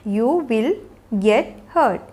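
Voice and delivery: slow, with gaps between words. Shown as a demonstration. Teacher showing learners how to say an injury warning, word by word. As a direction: separate "You will", "get" and "hurt" with pauses. Teacher models phrase slowly, then joined.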